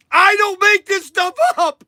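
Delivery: high, worked-up exclamation; emotional outburst. Real, not acted. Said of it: "I shouldn't get so emotional".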